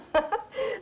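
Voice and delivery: high laugh that cracks toward a sob; laugh, nearly crying; tearful laugh. Acted. Laughing mid-story.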